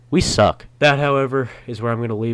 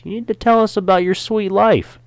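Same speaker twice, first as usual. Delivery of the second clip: higher, teasing whine; whining; 6 dB louder than usual. Acted. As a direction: needle him like a pesky kid. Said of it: "a whining voice".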